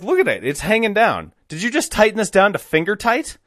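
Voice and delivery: high, incredulous telling-off; mock-scolding. Bosses scolding installer over loose hitch, incredulous. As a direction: imitate a boss aghast at shoddy work.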